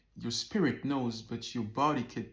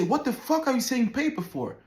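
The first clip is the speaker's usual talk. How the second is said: high and loud mock snap; hammed-up retelling of a rude put-down; mock angry snap; 10 semitones higher, 4 dB louder than usual. Friends mocking him for saying paper, loud mocking snap.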